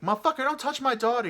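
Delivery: high, loud, fast mock outburst; hammed-up woman's screech, quick and piercing; arguing mother impression. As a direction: rattle it off like an outraged mother. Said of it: "they start arguing".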